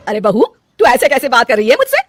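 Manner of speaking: mother-in-law hitting back at her rude daughter-in-law; offended rebuke. Acted. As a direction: scold her for her tone.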